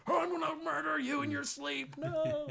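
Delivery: high, loud hammed-up killer; haunted house actor threatening kids, hammed up; menacing monster. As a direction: snarl it like a horror villain. Real scary actors.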